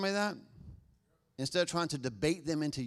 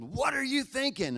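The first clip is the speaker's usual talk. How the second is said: sharp, much higher angry demand; anger-filled; 11 semitones higher than usual. Shown as a demonstration. Parent snapping at kids, angry high voice. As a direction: scold like a frustrated parent. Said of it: "anger and fear-filled".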